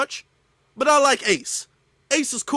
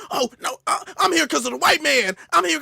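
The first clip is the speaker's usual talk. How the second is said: mock indignant immigrant; 7 semitones higher, 3 dB louder than usual. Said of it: "You can always go home".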